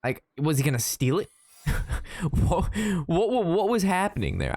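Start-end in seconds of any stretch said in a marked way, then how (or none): 1.6-4.5 higher, laughing disbelief; amused bewilderment, words broken by laughter; baffled laughing. Real, not acted. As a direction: splutter it out through a laugh, bewildered. Laughs, baffled at his own story.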